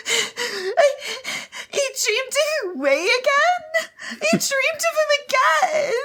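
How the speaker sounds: high, slow, breathy whine; shrill distressed Valley Girl. Acted. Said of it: "And Wan Ling Chao's like".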